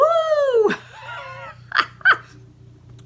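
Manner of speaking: high delighted squeal laugh; excited squeal. Real, not acted. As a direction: shriek with joy. Squeal over socks.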